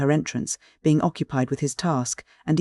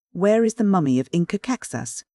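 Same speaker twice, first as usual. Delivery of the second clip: ordinary delivery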